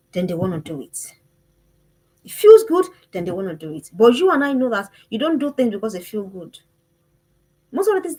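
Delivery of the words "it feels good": high, louder gush of delight; mock girl's excitement. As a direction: gush it like a giddy girl. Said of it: "girls' thoughtless impulse".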